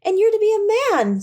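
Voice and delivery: put-on high, eager child's voice; little girl. A narrator's reading. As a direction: play the little sister bursting with joy. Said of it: "brimful of glee".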